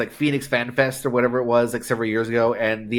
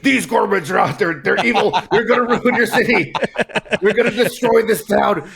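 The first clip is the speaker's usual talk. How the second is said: higher, louder ranting newspaper boss laughing through it; Jonah Jameson rant; 7 semitones higher, 3 dB louder than usual. Retold or imitated. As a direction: rant like a tabloid editor whipping up fear. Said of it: "Jonah Jameson joke where he's like".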